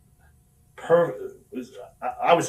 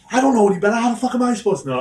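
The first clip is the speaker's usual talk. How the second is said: raised, high-pitched mock protest; acted argument; 10 semitones higher, 7 dB louder, faster than usual. Wrestler faking a fight with Sean, loud retold protest. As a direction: imitate yourself arguing back loudly.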